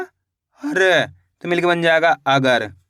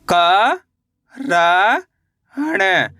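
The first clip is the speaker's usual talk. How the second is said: each letter drawn out, with a long pause after it; stretched, separated syllables; much slower than usual. Shown as a demonstration. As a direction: sound out the letters one at a time for learners. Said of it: "मेरे साथ साथ इनको repeat".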